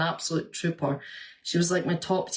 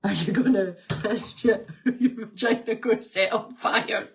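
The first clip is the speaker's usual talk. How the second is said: laughing tease; 4 semitones higher than usual. Retold or imitated. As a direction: tease her through laughter. Retold friend teasing quote.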